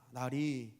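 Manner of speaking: lightly voiced deferential servant, low and slow; a hint of a humble servant, drawn out; servant addressing master. Groom inviting his royal master to believe, lightly deferential. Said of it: "왕손한테 가다가 얘기합니다".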